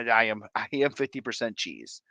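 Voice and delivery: usual speaking voice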